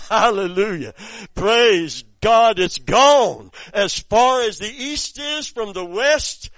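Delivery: full-force preaching. Acted. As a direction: proclaim it to the whole church. Preaching climax.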